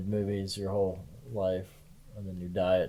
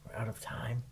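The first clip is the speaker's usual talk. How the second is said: whisper yelling; 2 semitones higher, 10 dB quieter than usual. Pretend. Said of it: "Why are we whispering?".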